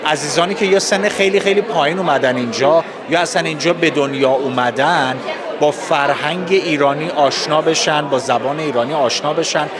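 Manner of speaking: ordinary talk, nothing marked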